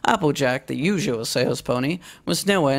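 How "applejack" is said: ordinary delivery, nothing marked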